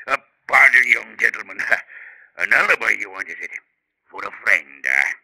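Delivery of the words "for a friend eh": ordinary delivery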